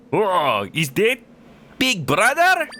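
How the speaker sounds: high, rising disbelief; surprised question. Real, not acted. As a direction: ask it in sudden shock. Spotting the brother.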